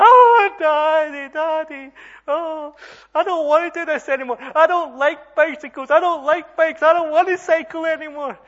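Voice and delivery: very high, loud mock bawling; hammed-up little-girl tantrum of tears; wailing child. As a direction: imitate a hurt kid wailing in protest. Hurt daughter wails to father.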